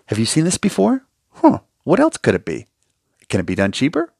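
a patient calmly challenging, politely curious; curious questioning. Pretend. Patient quizzing the dentist in the chair, curious and probing. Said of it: "in a informative, intellectual sort of way".

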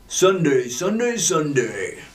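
hyped announcer Sunday Sunday Sunday; monster truck ad. Pretend. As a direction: sell it like a truck rally ad.

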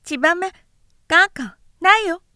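put-on high, loud, fast childish outburst; a small boy's sudden angry cry; angry toddler. A narrator's reading. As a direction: snap it like a hurt little boy. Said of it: "怒ったように言った".

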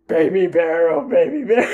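put-on stiff grumpy man hamming up a bear for a kid; baby bear voice. Otto reading the bear for the girl, laughing mock bear voice. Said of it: "he starts doing the baby bear voice".

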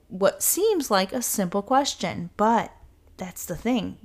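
normal talking voice

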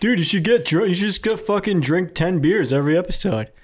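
high goofy dude voice; mocking fan voice. Voicing fans' advice.

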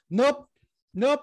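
high, loud nope-nope dismissal; mock dismissive ref. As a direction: wave him off with a high mocking voice. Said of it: "And the guy's like".

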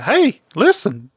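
high, slow fairy call; Navi. Retold or imitated. Wanting Navi's two words, mock fairy voice. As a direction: stretch it like a little game fairy. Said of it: "I need the voice actor for Navi".

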